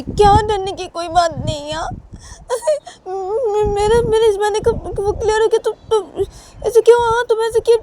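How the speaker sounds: very high, loud, tearful wailing; crying panic. Acted. Frightened wife wailing back at her husband. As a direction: wail in fear between sobs.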